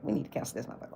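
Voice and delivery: unvoiced conspiratorial whisper; hushed whispered verdict. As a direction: breathe it like a secret. Whispered aside.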